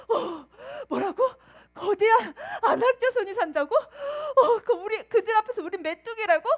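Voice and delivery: high, tearful, panicky wail; mock crying dismay. The people wailing over the report, mock crying. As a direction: sob out the bad news in mock despair. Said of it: "하면서 다 같이 심장이 메뚜기처럼 쪼그라들었을".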